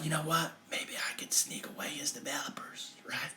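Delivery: whispered, as if plotting in secret; sneaky whisper. Retold or imitated. Retelling his plan to lure developers away, whispered. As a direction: hush it like a sly scheme.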